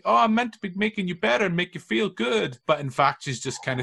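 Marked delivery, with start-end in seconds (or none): 0.0-2.6 flatmate voice. Retold or imitated. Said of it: "she's like".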